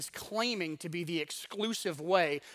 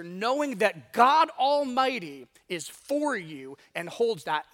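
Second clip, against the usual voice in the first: loud, slow, building sermon climax; preaching at full force; 3 semitones higher, 8 dB louder, slower than usual. Acted. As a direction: drive each phrase home to the church.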